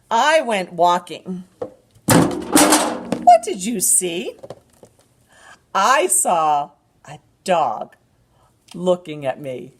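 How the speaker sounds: slow, animated picture-book reading; drawn-out storytime voice with gasps; reading to a toddler. Acted.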